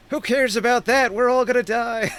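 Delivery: high, frantic acted panic; screaming villagers. Terrified villagers answering the shopkeeper, high panicked cry. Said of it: "the villagers are screaming".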